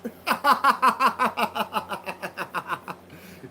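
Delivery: a long helpless laugh at the chat; hearty real laughter. Real, not acted. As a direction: laugh it out, amused. Laughs at chat's reply.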